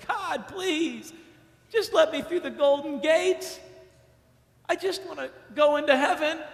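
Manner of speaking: high, whiny begging at the gates; mock pleading. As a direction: beg God to be let in. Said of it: "look and say".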